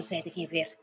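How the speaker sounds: ordinary delivery, nothing marked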